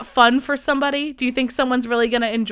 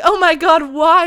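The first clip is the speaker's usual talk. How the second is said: higher and louder, giddy cry; retelling a giddy outburst over the wine; mock tipsy exclaim; 7 semitones higher, 8 dB louder than usual. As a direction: exclaim it, carried away and tipsy. Retold tipsy reaction to wine.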